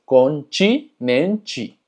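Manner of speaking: continente slowly, syllable by syllable, as "conchinenchi"; TI y TE como chi. Shown as a demonstration. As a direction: spell out both "chi" sounds in continente. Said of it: "termina en T E". (continente pausado, dicho «conchinenchi»)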